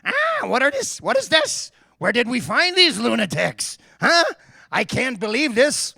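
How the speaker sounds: put-on high-pitched, outraged French accent; hammed-up French-accent rant; livid French-accented manager. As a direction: imitate a French manager throwing a fit. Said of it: "going on and on in his French accent".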